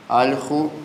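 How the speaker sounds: usual speaking voice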